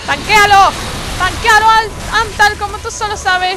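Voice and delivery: high, loud rallying yell; urgent shouted order. Real, not acted. Player telling Antal to tank the boss, urgent shout. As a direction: holler orders to your teammate.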